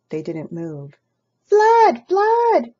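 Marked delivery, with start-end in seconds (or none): 1.4-2.7 high, loud shout of flood; alarm cry. Acted. Cat shouts flood at the birds, high and loud. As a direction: yell a false alarm. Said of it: "he cried".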